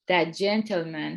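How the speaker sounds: normal talking voice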